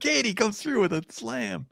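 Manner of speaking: high, excited call-out; excited announcer shout. Real, not acted. Co-host hailing Katie's donation, excited shout. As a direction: shout it like a big play just landed.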